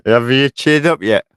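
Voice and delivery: loud teasing call to another room; husband calls out to his grumpy wife, loud and teasing. Real, not acted.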